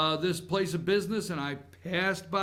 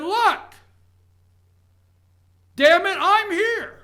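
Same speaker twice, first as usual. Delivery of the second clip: much louder and higher, slow and forceful; retold angry shout; 12 semitones higher, 10 dB louder, slower than usual. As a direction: shout it slowly, demanding to be seen. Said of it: "I said".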